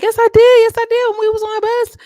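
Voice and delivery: high, loud retort, hammed up; insistent mock yelling. Vlogger acts out her answering Mel's accusation, insistent mock yell. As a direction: imitate someone shouting back in a quarrel.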